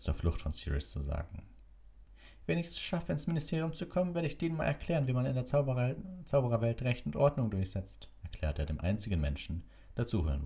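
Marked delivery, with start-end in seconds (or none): 2.4-8.1 high, self-important voice; pompous Percy. A narrator's reading. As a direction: puff up with importance.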